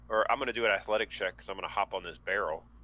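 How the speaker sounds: ordinary talk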